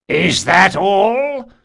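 high, loud, slow taunt; loud cry. A narrator's reading. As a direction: cry it out to the crowd. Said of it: "cried the chief demon".

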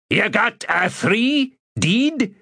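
put-on slow, high South Islander accent; anxious South Islander accent. Keeper's accent.

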